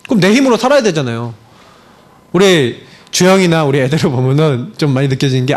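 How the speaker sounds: normal talking voice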